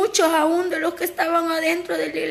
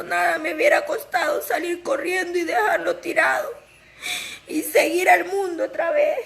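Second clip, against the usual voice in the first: real tearful testimony. Real, not acted. Woman confessing her tender heart to the congregation, tearful. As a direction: voice breaking with tears, catching between phrases. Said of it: "yo soy una gran llorona".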